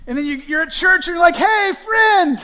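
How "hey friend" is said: high, loud, fake hey friend; insincere greeting. Pastor mocking a hollow church greeting, loud. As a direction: greet like you don't mean it. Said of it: "that was super insincere".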